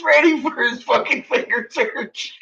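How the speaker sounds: high, wheezy with real laughter; cracking up at your own punchline; laughing while talking. Real, not acted. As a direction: fight through the giggles to finish. Teller cracking up at his own story.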